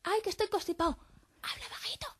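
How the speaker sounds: whispered demo of someone with a cold; hablar sin voz. Shown as a demonstration. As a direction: whisper it with no voice at all. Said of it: "el hablar sin voz". (susurro sin voz, de constipado)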